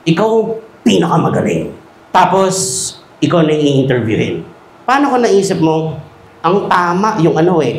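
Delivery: ordinary talk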